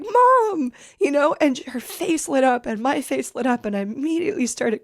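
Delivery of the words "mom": high, louder call of Mom; tearful cry. Retold or imitated. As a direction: cry out to her mother. Reunion, daughter cries out.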